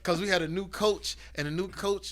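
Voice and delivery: ordinary delivery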